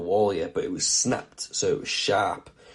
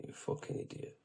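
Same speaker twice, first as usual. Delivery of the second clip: whispered grief; 17 dB quieter than usual. Retold or imitated. Repeats thought after death news.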